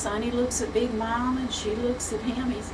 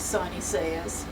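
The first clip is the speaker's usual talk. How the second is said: ordinary delivery, nothing marked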